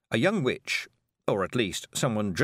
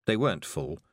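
usual speaking voice

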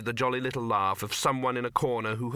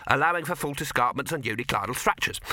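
quicker dwarf repetition; brisk, impatient echo of a technicality; brisk concession; 3 semitones higher than usual. Acted. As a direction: rattle off the caveat to get it accepted. First dwarf concedes the caveat.

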